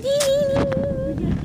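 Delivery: high sing-song ding; car chime imitation.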